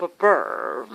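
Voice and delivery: a lightly affected burr on the word; put-on burr. Shown as a demonstration. He demonstrates the put-on polish of a doctor, mocking. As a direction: show the posh sound he has to put on. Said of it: "I gotta put a".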